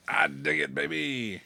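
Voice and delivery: usual speaking voice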